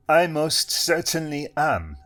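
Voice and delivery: put-on Eastern European accent. A narrator's reading. Hypnotist greeting Benson, Eastern European accent. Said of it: "Eastern European".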